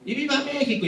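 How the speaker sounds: mock a rowdy reveller shouting; mock drunken yell. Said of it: "gritando como locos".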